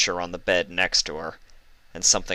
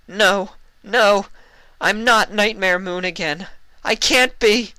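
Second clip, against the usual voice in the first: high, louder pleading voice; desperate denial; 14 semitones higher, 6 dB louder than usual. Acted. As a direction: beg it not to be true. Luna wakes in tearful panic.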